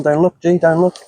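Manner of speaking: normal talking voice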